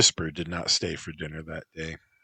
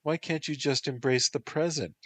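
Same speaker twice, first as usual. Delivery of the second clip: pouting; 8 semitones higher than usual. Acted. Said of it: "she pouted".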